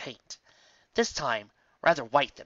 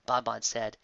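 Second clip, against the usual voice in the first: plain reading voice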